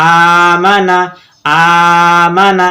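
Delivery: slow, stretched start to আমানা; drawn-out opening vowel. Shown as a demonstration. Teacher demonstrates the hamza, first vowel of আমানা held long. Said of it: "শুধুমাত্র আমরা হামজা টেনে পড়ছি".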